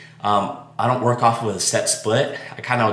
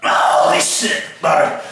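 out of breath; 6 semitones higher, 5 dB louder than usual. Real, not acted. Gasping after a hard set.

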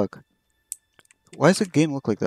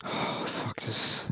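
whisper; 2 semitones lower, 12 dB quieter, much slower than usual. Real, not acted. Scared in dark game.

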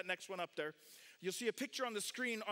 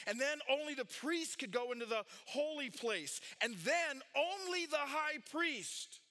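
raised pitch, punching each restriction; emphatic preaching; 7 semitones higher than usual. Real, not acted. Preacher explaining who could enter the temple, emphatic preaching. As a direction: drive the point home to the congregation.

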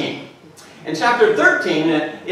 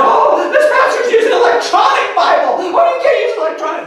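much higher, louder scandalised voice, laughing; mock judgmental gasp; 6 dB louder than usual. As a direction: play the shocked church gossip. Mocking judgmental churchgoers.

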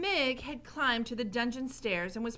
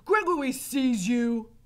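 loud, slow warning, pitched up; Gregory; 4 semitones higher, 10 dB louder than usual. A narrator's reading. Gregory to the rat shadow, loud slow warning. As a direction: call it out slowly, heavy character voice. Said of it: "Gregory said to the rat shadow".

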